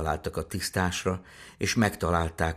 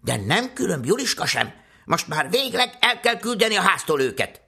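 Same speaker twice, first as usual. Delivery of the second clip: indignant, high and louder tirade; scolding that climbs higher and louder; accusing stepmother; 10 semitones higher, 6 dB louder than usual. Acted. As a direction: denounce the children and demand they go. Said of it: "vádolta Jancsit".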